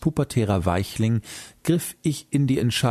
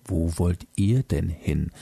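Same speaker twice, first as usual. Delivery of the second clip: lower, stern voice looking down on them; stern moon voice; 5 semitones lower than usual. A narrator's reading. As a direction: ask it like a strict guardian, low and stern. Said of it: "schaute streng auf uns hinab".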